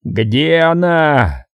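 slow, dragged-out furious question; yelled demand. A narrator's reading. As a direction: force each word out slowly. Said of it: "проорал он". (медленный яростный вопрос)

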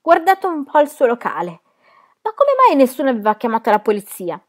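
high, loud outcry; calling out loudly. A narrator's reading. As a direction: complain at the top of the voice. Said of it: "chiamando a gran voce la polizia".

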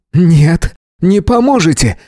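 high, loud shrieking no; hysterical squeal. A narrator's reading. Earthling refuses help, hysterical high squeal. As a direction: shriek it in panic. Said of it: "истерично взвизгнул землянин".

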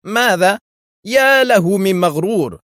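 loud, high-pitched outraged exclamation; angry shout. A narrator's reading. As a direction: explode with outrage, stretching the insult. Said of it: "صاح في غضب". (صياح غاضب)

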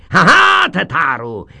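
high, loud, triumphant teacher crowing; loud gloating schoolmaster; gleeful schoolmaster shout. Acted. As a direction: crow it out like a pleased tyrant.

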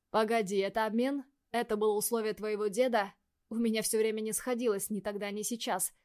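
ordinary delivery

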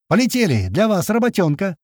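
high, carrying rallying calls; energetic hollered summons; yelling. A narrator's reading. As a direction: shout round for the squad. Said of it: "это уже Крот орёт".

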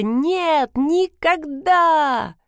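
high, drawn-out cry of refusal; long wailed refusal, acted; loud protest. A narrator's reading. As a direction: let it burst out of your throat. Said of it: "Из горла рвалось громкое".

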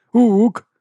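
high, loud animal hoot; librarian's hoot. A narrator's reading. The librarian confirms the news to the guards, ape-like hoot.

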